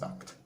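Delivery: Nacht said softly as "nakt"; low, quiet "nakt" for Nacht; demonstrated German pronunciation. Shown as a demonstration. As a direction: show Nacht with a short "a" and hard "kt". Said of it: "so it's pronounced".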